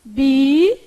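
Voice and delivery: careful syllable-by-syllable "Bi"; syllable split demo. Shown as a demonstration. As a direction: draw out the first syllable "Bi" by itself. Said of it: "como é que você separou bíceps".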